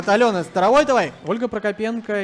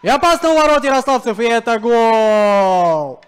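excited goal call; 5 dB louder than usual. Real, not acted. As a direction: race through, then stretch the goal. Goal call after fast build-up. (быстро, затем протяжное «гол»)

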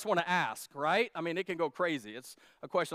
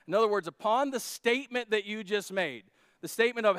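normal talking voice

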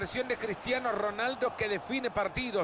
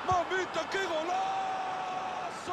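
goal scream; 7 semitones higher, much slower than usual. Real, not acted. Goal scored, commentator erupts.

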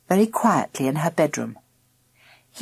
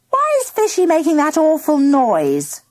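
put-on high-pitched girl's cry of complaint; indignant, raised-pitch question; 8 semitones higher, 3 dB louder than usual. A narrator's reading. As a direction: call out high over a racket. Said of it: "cried Mandy".

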